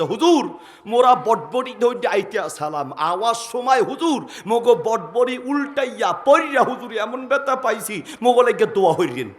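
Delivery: Barisal elders. Retold or imitated. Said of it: "বরিশালের মুরব্বী আসছে".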